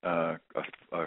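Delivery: ordinary talk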